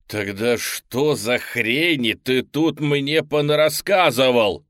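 loud, slow exasperated shout; snapping shout. Acted. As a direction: lose it and yell. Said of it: "сорвавшись, кричу я".

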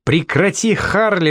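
high pleading shout; screaming. A narrator's reading. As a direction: shriek his name to stop him. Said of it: "вопила она".

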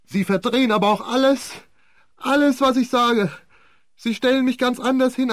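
high, loud, desperate protest; shrill hysterical defendant; hysterical outcry. A narrator's reading. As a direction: cry out in panic at the accusations. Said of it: "schrie hysterisch in den Saal".

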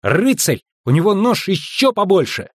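put-on high, piping child voice; squeaky little boy. A narrator's reading. Boy boasting of his father's knife, squeaky. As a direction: boast like an eager small boy. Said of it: "пропищал Эверетт".

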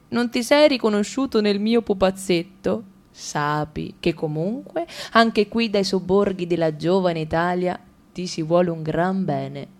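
normal talking voice

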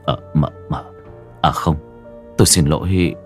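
low, halting apology; flustered stammer. Acted. Servant caught leaning in to kiss the mistress, flustered low stammer. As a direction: stumble softly over the words.

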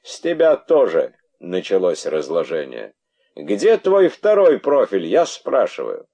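high, loud threatening demand. Acted. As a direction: press him menacingly. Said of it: "пригрозил царь".

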